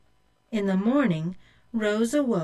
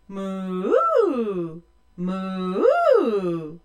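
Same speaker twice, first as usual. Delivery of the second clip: drawn-out louder cow moo; 4 dB louder than usual. Acted. The two-headed cow mooing at Rose, loud moo.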